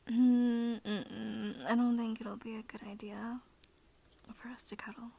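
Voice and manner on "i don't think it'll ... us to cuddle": ASMR whisper. Acted. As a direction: hush it close to the mic. Holds soft ASMR whisper.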